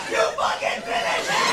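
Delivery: whispered strained shout; hoarse fan cheer. Retold or imitated. Comic eggs her on like a wrestling fan, breathy strained yell. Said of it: "I was like".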